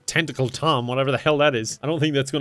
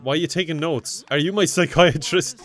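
normal talking voice

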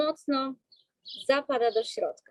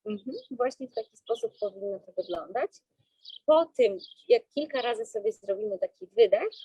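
usual speaking voice

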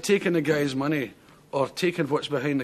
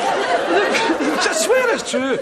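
laughing; 12 semitones higher, 5 dB louder, much slower than usual. Real, not acted. Laughing about singing to candles.

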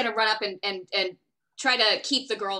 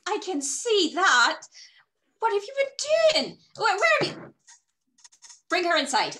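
high-pitched, exclaiming mother; flustered alarm; 10 semitones higher, 4 dB louder than usual. Acted. Mother to rider with bleeding girl, flustered alarm.

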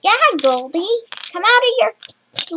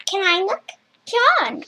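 ordinary talk

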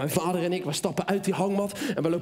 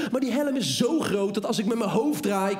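ordinary delivery

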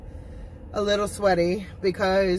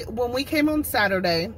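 ordinary talk, nothing marked

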